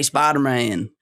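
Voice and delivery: "Spider-Man" with Southern drawled vowels; Deep South accent. Shown as a demonstration. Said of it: "No, that is the Deep South".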